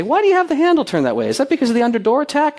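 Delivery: lightly voiced inquisitive self; curious question. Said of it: "I've asked building managers, say".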